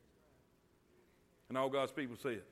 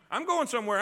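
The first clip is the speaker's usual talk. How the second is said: ordinary talk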